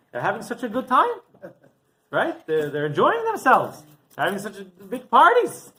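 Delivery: lightly acted disbelief at others' good fortune; indignant complaint. Rabbi lamenting the Romans' celebrations.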